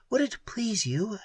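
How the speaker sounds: a soft, gentle question; woman softly asks the hero to promise; softly asked. Acted. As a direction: ask it softly, almost a murmur. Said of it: "she asked softly".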